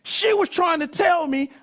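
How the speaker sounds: shouting high with laughing excitement; loud realization. Real, not acted. Preacher shouting his realization to the congregation, laughing. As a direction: blurt out the sudden discovery. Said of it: "bust out loud".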